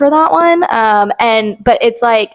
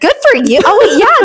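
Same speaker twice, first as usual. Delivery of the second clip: high, loud gleeful cry; excited squeal; 10 semitones higher, 3 dB louder than usual. Real, not acted. Teasing her co-host about leaving, with a gleeful high squeal. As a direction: squeal it with delight.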